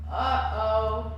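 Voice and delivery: loud sing-song warning; playful uh-oh. Acted. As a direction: make the kids feel trouble coming. Narrator warns of trouble.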